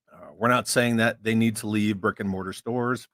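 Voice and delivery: ordinary delivery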